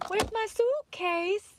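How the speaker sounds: put-on high, drawn-out little-kid Slovenian accent; Barron Trump. Imitating the viral clip of Trump's son, accented child voice. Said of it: "Barron Trump".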